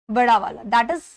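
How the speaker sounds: ordinary talk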